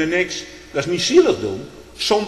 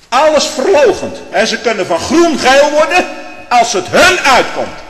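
thundering denunciation; 10 semitones higher, 6 dB louder than usual. Real, not acted. Condemning those who betrayed the church, in a loud thundering voice. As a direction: hurl it at the apostates. Preacher denounces traitors.

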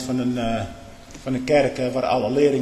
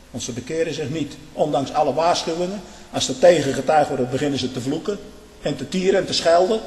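ordinary delivery

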